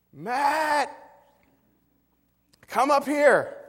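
loud, high-pitched holler from upstairs; calling out. Retold or imitated. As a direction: yell for your kid to come up, drawn out. Mom calls him from upstairs.

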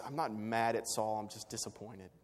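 understated, hushed disappointment; quiet disappointment. Retold or imitated. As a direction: let it drop away in gentle letdown. God speaking gently, imagined.

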